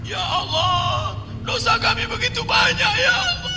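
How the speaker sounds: high wailing cry to God; crying prayer. Retold or imitated. Preacher imitates weeping pilgrims in prayer, high crying wail.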